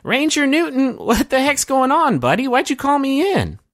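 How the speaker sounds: very high-pitched shout; high shouting ranger. Acted. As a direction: call out in a squeaky high voice. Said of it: "she shouts".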